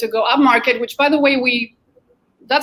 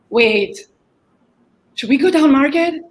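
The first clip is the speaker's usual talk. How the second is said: worried inner voice; 5 semitones higher, 5 dB louder than usual. Retold or imitated. Retold worried team debate.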